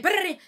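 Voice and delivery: high buzzing brrr; magic sound effect. Retold or imitated. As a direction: make a magic power-up noise. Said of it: "She's like".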